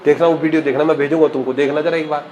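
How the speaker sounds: normal talking voice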